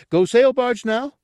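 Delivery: very high, loud, eager question; eager simpleton asking for a treat; hopeful. A narrator's reading. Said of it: "Gartog repeated hopefully".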